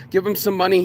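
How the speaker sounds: ordinary talk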